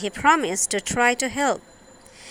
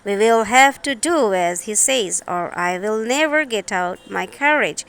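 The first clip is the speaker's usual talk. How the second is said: ordinary delivery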